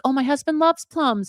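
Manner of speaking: lightly voiced happy neighbor; pleased neighbor. Neighbor pleased to be offered plums, delighted.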